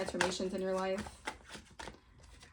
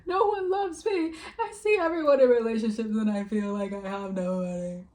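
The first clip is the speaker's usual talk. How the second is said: mock sobbing; 5 dB louder than usual. Mocking the person who wanted you crying alone. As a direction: play the lonely crybaby, overdone. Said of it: "they wanted you sobbing and crying and being like".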